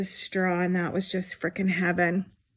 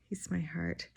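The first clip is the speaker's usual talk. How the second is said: much softer, almost breathed; tender, hushed; 3 semitones lower, 12 dB quieter than usual. Real, not acted. Aunt doting on her baby nephew: soft, hushed adoration. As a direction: say it again barely above a breath, adoring. Repeated softer after sigh.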